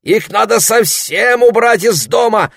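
high, loud, worked-up insistence; Larry insisting the snakes leave the house; agitated protest. Acted. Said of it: "волновался Ларри".

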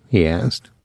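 ordinary delivery

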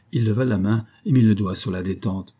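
plain reading voice

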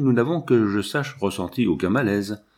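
plain reading voice

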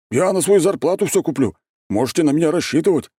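high, eager, delighted offer; overjoyed. A narrator's reading. Григорий offers to fetch food supplies, overjoyed. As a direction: pitch it up with keen, happy eagerness. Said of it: "радостно обрадовался Григорий".

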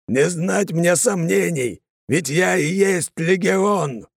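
slow, high, ringing oath; solemn oath. Acted. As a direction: proclaim it like a sacred vow. Continuing the legion code. (торжественная медленная клятва)

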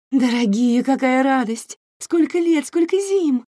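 high, loud, mannered diva voice; Wanda Osiris mannered intonation. Acted. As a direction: gush like a theatrical showgirl star. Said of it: "подражая манерным интонациям Ванды Осирис".

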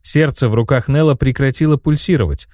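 plain reading voice

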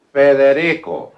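Federico loud, high and drawn out; "Federico" belted out, each syllable stretched; careful demonstration. Acted. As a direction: proclaim Federico slowly to prove you can say it. Shows doctor how he says it.

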